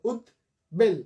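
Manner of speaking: উদ্বেল broken into ud and bel; syllable by syllable. Shown as a demonstration. Teacher counting syllables for students, ud then bel. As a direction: pause between the halves of উদ্বেল. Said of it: "দুটো অক্ষর".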